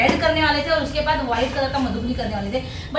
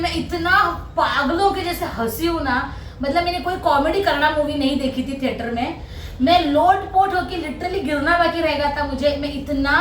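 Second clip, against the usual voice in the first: ordinary talk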